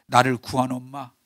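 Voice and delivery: ordinary delivery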